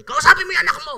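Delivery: high, loud demand to the husband; mother's yell. Retold or imitated. Angry mother yelling at father to talk to son. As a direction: yell across the house for him to deal with it.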